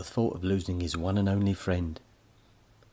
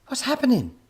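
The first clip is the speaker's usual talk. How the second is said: put-on alarmed boy; 7 dB louder than usual. A narrator's reading.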